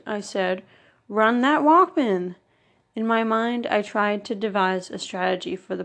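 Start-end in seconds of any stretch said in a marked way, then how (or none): 1.1-2.4 loud, slow, drawn-out order; bully's loud command, stretched out and rising high before the drop; fuming demand. A narrator's reading. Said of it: "Smurf fumed".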